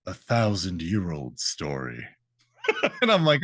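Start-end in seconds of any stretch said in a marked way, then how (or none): 0.1-2.0 low, soft, weighty reply; storytelling gargoyle. Acted. Gargoyle answers the newcomers, low solemn understatement. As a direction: drop low and quiet, with gravity. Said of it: "And he's like".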